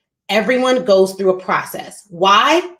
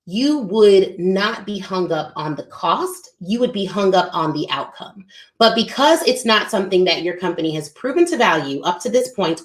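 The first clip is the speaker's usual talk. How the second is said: ordinary talk, nothing marked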